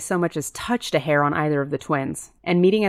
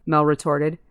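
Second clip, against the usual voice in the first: ordinary delivery, nothing marked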